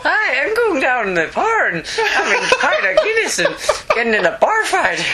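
high, laughing stage Irish; hammed-up Irish pub talk; Irish accent. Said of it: "That was appallingly bad".

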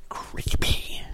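voiceless hushed whisper; creepy whisper. Real, not acted. Reviewer recalls a horror film in a spooky whisper. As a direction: breathe the word out spookily. Remembering an Evil Dead scene.

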